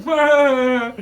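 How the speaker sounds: high loud yelp; startled yelp. Retold or imitated. Neighbor kid dodging a brick, startled loud yelp.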